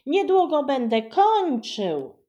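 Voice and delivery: put-on high, loud child voice; boy boasting out loud; bragging boy. A narrator's reading. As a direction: push ahead of his sister.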